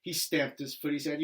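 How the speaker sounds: plain reading voice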